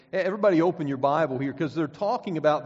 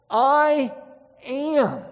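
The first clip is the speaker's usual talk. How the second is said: I, pause, then am, louder and higher; dramatic pause; 8 semitones higher, 6 dB louder than usual. Shown as a demonstration. Preacher proclaiming Jesus's answer with a dramatic pause. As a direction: split the words and let them ring. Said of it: "that he had before Abraham was?".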